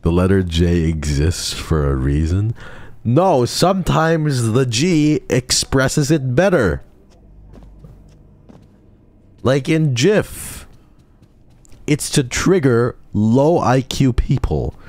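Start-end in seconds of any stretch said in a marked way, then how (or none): 3.0-14.8 high, slow mocking voice for the other side; high, drawn-out mock of chat. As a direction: parody the know-it-all who says it wrong.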